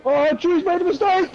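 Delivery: high, urgent commentator's cry; excited outburst. Real, not acted. Commentator calling a driver's mistake to viewers, excited. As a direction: pitch leaps up at the blunder.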